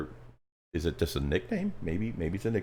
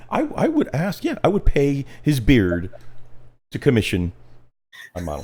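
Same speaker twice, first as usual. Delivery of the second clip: excited; 8 semitones higher, 8 dB louder than usual. Real, not acted. Excited exclamation.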